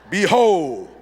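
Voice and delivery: proud, grandiose proclamation; grand announcement. Retold or imitated. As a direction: announce like a herald. Son presents report card grandly.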